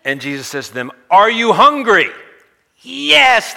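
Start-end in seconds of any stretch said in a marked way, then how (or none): 1.1-3.5 high, drawn-out rally shout; firing up the crowd. Acted. Jesus rousing a hungry crowd, call-and-response shout. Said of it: "He gets them all fired up".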